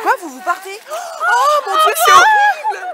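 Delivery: dramatic wail. Pretend. Friends leaving, mock despair.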